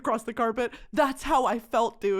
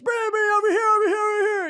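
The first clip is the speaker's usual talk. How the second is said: higher, louder calling out over here; mock photographer calls; 10 semitones higher, 5 dB louder, faster than usual. Imitating carpet photographers, higher calls to come over. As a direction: call her over like a pushy photographer. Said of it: "I've been on some carpets where it's".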